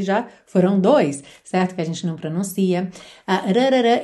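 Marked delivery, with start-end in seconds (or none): none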